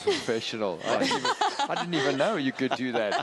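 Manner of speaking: high laughing disbelief. Real, not acted. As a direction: laugh in surprise. Laughing at the co-host's preparation.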